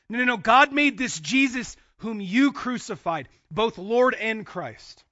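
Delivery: forceful proclamation. Acted. Peter confronting the crowd about Jesus, forceful proclamation. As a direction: drive each key word home loudly.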